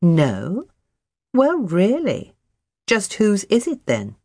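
haughty, drawn-out challenge; arrogant. Acted. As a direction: toss it back like a proud cat. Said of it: "meows arrogantly as if to say".

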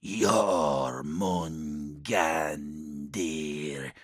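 faint, far-off demon voice; distant demon voice. Acted. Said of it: "The voice sounded as if from a great distance now".

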